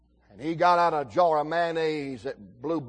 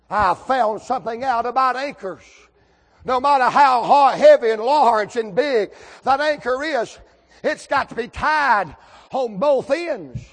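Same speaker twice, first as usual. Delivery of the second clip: high, loud pulpit delivery; ringing, hollered sermon point; full-force preaching; 7 semitones higher, 11 dB louder than usual. Real, not acted. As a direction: drive the lesson home, slowing on key phrases.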